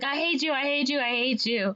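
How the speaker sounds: high-pitched mock upset outburst; upset kid. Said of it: "and he's very upset".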